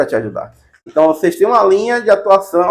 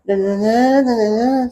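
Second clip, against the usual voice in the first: higher mock lazy "né, né, né"; teacher mocking a reluctant presenter, higher; unenthusiastic student; 5 semitones higher, much slower than usual. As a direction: parody a student with no will to present. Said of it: "pra vocês falarem".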